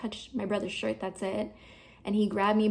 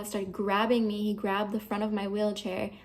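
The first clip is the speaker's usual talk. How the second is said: normal talking voice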